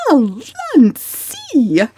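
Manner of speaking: a mother's stately protest turned whine; imploring protest. A narrator's reading. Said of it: "protest wrung from Missus Frederick".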